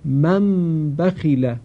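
من بخیله slowly, the n of من turned into a nasal m gliding into ب; slow careful tajweed reading of من بخیله, n becomes m; iqlab demo. Shown as a demonstration. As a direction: read من بخیله slowly as "mam bakhila". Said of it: "یک بار دیگه".

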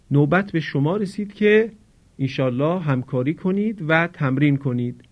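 normal talking voice

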